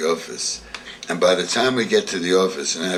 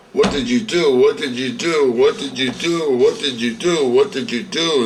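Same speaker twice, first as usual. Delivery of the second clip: higher, louder repeated demand from a raving uncle; ranting uncle; 6 semitones higher, 5 dB louder than usual. Retold or imitated. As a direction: throw the question at him over and over, much higher and louder. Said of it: "he's just ranting and raving".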